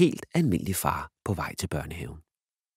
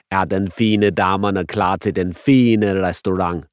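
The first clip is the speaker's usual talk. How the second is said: slow, louder, hammed-up waiter voice; Italian waiter; 7 dB louder, slower than usual. Dad as waiter greeting the fine ladies, hammed up. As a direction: play the grand Italian waiter. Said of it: "den italienske tjener".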